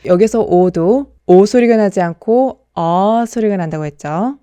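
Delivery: ordinary delivery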